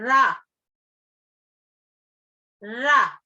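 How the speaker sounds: "रा" with a trilled, quivering tongue tip; trilled ra demo. Shown as a demonstration. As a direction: let the r roll for the class. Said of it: "जीभ की नोक कांपती है". ("रा" को जीभ कंपाकर बोलना)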